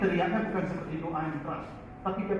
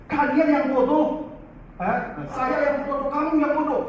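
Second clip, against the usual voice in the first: mock angry teacher; 8 semitones higher than usual. Teacher voiced scolding his pupils.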